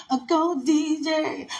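high, drawn-out cheer; hype man. Shown as a demonstration. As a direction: sing out a hype call to the DJ. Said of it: "get the mic and I say that".

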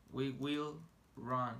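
"We will run" said very slowly; deliberate classroom demo; slow model. Shown as a demonstration. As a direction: sound out "We will run" word by word. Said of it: "teacher models English slowly".

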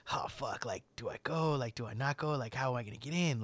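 hushed, nervous whisper to himself; retelling his panic in a breathless hush; panicked whisper. Retold or imitated. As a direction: whisper it like you're scared of being caught. Retold nervous inner thoughts.

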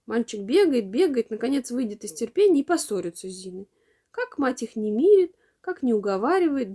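usual speaking voice

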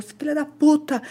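retold angry insult. Boyfriend who lost control cursing her, imitated anger. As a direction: spit out the quoted curse.

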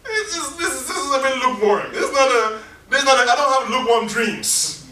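rally disciples like a prophet on fire; zealous Elisha. Retold or imitated. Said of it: "Elisha was like".

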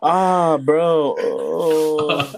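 real outburst of dismay; loud dismayed reaction. Real, not acted. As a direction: cry out at something gross. Reacting to eating the tamale wrapper.

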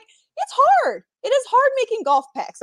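high-pitched playful protest; mock pleading. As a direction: plead your case in a high, put-upon voice. Retells her pleading reply to critics.

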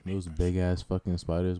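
ordinary talk

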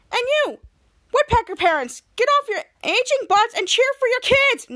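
high, loud scolding call; yelling at parents. Retold or imitated. Coach scolding the parents in the stands to cheer. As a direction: shout across the gym to shame the parents.

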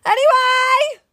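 exasperated loud anyway. Real, not acted. Cutting off partner's chatter.

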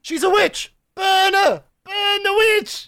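high-pitched witch-hunt cry; mob shouting. Acted. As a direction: rouse the villagers with a shrill-pitched chant. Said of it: "All of us shouting".

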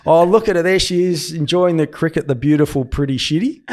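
gushing on air about a star in the crowd; commentator voice. Retold or imitated. On air to the cricket viewers, a lively loud commentator gush. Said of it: "And I said".

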